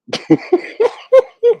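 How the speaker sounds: very high bursts of laughter; real laugh. Real, not acted. Host cracking up at a viewer's comment, laughing. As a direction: lose it in high, loud giggles.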